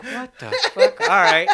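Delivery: imitating shock through laughter; laughing mock outrage. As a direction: crack up while cursing. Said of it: "it was like".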